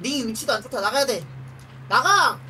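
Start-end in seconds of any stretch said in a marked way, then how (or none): 1.9-2.4 shouting. Real, not acted. Urging units out.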